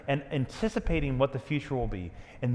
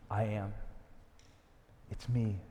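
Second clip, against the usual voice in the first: soft gentle reassurance; 5 semitones lower, 8 dB quieter than usual. Acted. Jesus voiced softly, pauses.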